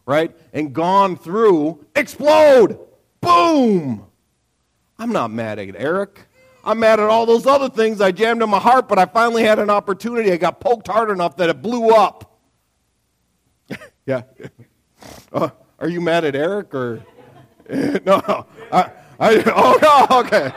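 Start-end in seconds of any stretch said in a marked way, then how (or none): 1.9-4.0 loud, high burst on "explode" and "Boom"; explosive shout. Pretend. Preacher acting out pent-up emotions bursting, shouting. 17.7-20.5 laughing. Real, not acted. Laughing at listener's question.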